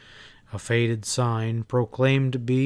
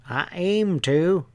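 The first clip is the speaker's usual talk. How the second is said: slow, higher placid stableman drawl; 6 semitones higher, slower than usual. Acted. Stableman drawling calmly at a glaring Ezra. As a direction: draw it out lazily, unbothered. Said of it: "the man said in a placid drawl".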